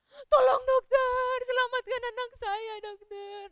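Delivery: high wail, loud then fading; crying plea. Acted. Mother begs the doctor to save her child, crying. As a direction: beg desperately in a hammed-up sob. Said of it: "Nangis ya mamanya".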